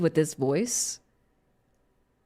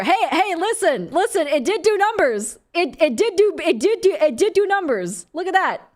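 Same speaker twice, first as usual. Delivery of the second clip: excited; 5 semitones higher, 4 dB louder than usual. Real, not acted. Excited streamer defending clip.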